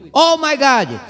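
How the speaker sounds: a hammed-up Hollywood gasp of alarm; like a passenger on a falling plane, lightly acted; mock panicked exclamation. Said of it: "Eu escutei muito isso".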